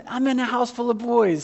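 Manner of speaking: usual speaking voice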